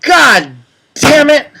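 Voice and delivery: loud, high-pitched real exasperation; frustrated curse. Real, not acted. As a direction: swear loudly over a ruined joke. Frustrated at ruined punchline.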